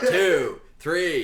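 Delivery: light mock drill sergeant count; barking a count, half in jest. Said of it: "it's got, like, a drill sergeant voice".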